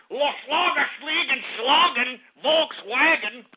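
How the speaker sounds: slow, high mock-German gibberish; mock German accent. Host hailing German brethren, hammed-up mock German accent.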